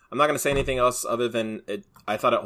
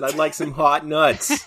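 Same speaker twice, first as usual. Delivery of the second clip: slow, laughing polite ask; mock request, laughing; 5 semitones higher, 6 dB louder, slower than usual. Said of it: "I said".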